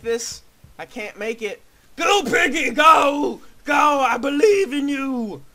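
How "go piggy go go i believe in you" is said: high, loud put-on cheer. Cheering the pig on in a silly voice. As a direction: shout him on in a silly voice. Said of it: "I'm done with that voice".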